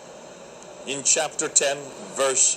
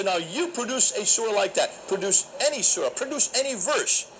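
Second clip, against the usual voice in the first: Speaker's Corner voice; 8 semitones higher than usual. Retold or imitated. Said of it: "I use my Speaker's Corner voice".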